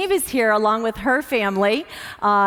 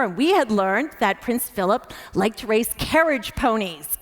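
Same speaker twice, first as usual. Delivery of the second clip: usual speaking voice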